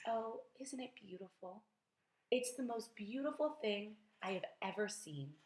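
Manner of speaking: hushed, spellbound wonder; quiet murmur. A narrator's reading. Spellbound boy marvels to himself, quiet murmur. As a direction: murmur it softly to yourself. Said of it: "murmur quietly to himself".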